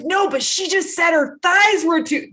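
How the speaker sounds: put-on kids tattling. Child telling mom what another mom said, eager high tattle. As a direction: play the kid who can't wait to tell.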